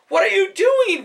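a high, loud, frantic cry at the soldiers; mother screaming after her child; panicked mother's cry. A narrator's reading. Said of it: "one mother cried".

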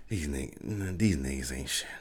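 soft, low, deadpan disgust; mock unimpressed fan. Voicing a viral fan at the scoreboard, deadpan scorn. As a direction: say it flat and fed up.

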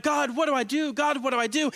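pleading prayer, pitched high; crying out. Acted. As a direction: cry out to God for guidance, acted. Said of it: "you, you cry out".

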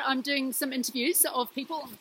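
ordinary delivery

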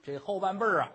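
ordinary delivery

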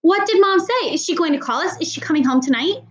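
high, urgent, worried questions; anxious child crying out. Acted. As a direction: blurt it out hoping for good news. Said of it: "to cry".

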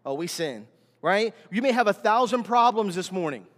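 ordinary talk, nothing marked

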